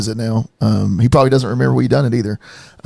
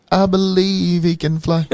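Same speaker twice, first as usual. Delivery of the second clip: high, drawn-out slow-motion voice; 7 semitones higher, slower than usual. Retold or imitated. Wrestler watching his thrown partner sail past, mock slow motion. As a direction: stretch it out as if time slows. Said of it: "in slow motion".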